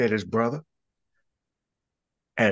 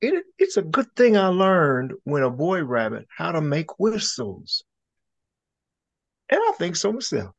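pleased rabbit gentleman; 8 semitones higher than usual. Acted.

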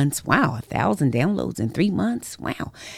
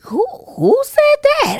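high, loud startled cry; loud, high retold fright; 8 dB louder than usual. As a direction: jump at a voice out of nowhere. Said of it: "I just was startled".

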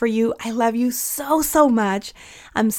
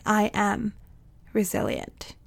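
quiet under-breath affirmation; 7 dB quieter, slower than usual. Shown as a demonstration. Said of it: "I get to whisper this word under my breath".

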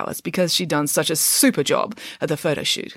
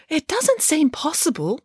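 high-pitched doubting piglet; 9 semitones higher than usual. A narrator's reading. Piglet doubts the others survived, high piglet voice. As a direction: pipe up as a small piglet. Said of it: "said her surviving piglet".

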